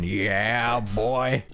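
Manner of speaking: zombie voice. Retold or imitated. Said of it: "but in zombie voice".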